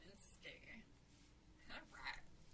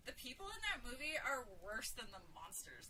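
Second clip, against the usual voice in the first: usual speaking voice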